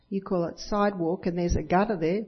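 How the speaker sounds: usual speaking voice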